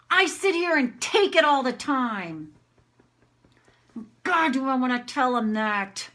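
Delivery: high, loud venting that eases off; demonstrated anger. Shown as a demonstration. Woman vents about being accused and taking it, angry. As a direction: rant indignantly, then let it settle. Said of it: "I'm just gonna do anger".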